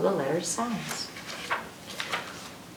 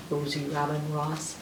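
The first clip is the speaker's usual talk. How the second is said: ordinary talk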